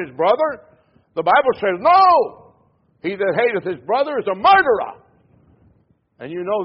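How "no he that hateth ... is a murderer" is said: loud, sharp No then firm verse; emphatic No. Acted. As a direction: slam down the No. Quoting the Bible emphatically.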